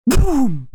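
sudden high "boom" for a blast; explosion boom. A narrator's reading. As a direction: imitate an explosion with a punchy boom. Narrator imitates the explosion.

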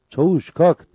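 high, loud wake-up call; rousing call. A narrator's reading. Cabbar rousing the sleeping sergeant.

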